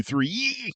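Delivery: "three" cried out high like in pain; "three" as a high pained wail. Shown as a demonstration. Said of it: "the three is in pain".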